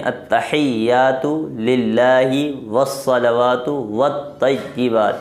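slow Arabic "اتحیات للہ والصلوات والطیبات"; careful recitation. Shown as a demonstration. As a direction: recite it slowly as a teacher. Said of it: "تو اتحیات صحیح کریں".

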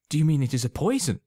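high, loud and fast, horrified; horrified cry. A narrator's reading. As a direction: blurt it out in sudden horror. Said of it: "very much horrified".

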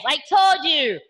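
ordinary delivery

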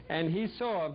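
usual speaking voice

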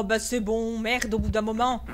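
usual speaking voice

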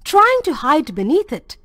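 ordinary delivery

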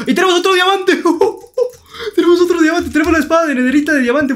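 very high, loud cry with a laugh; gleeful shout. Real, not acted. Gamer finding a diamond shouts in glee with a laugh. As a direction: burst out in delight at the diamond.

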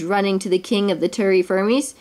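plain reading voice

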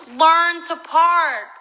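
slow, high-pitched bellow; outraged full-volume yell of the note; all-caps shout. Retold or imitated. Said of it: "in all caps".

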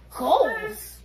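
stunned, mocking echo; incredulous. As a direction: repeat goals like you can't believe it. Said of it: "And I'm here like".

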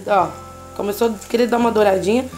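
usual speaking voice

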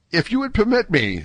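high-pitched, tentative request; timid. A narrator's reading. Young D'Artagnan asking to speak to Athos, timid. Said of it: "said D'Artagnan with timidity".

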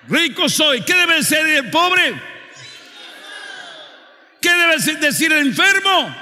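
loud, high, shouted preaching to the crowd; fiery preaching. Acted. Preacher rouses his congregation to declare faith, shouting. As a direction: preach it at full force, calling the church to answer.

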